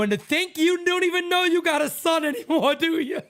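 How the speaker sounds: high, loud, laughing mock whine; guilt-trip mom in a squeaky put-on voice; teasing mom in a high laughing voice. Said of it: "I go, I go".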